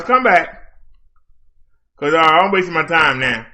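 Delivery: ordinary delivery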